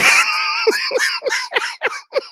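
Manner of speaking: high, long burst of laughter; high wheezing laugh; helpless laughter. Real, not acted. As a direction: lose it after the punchline. Cracks up at own story.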